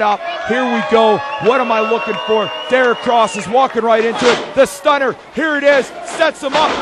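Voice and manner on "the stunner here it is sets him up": excited call. Real, not acted. Commentator calling the stunner to viewers, excited. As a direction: call the finisher as it lands.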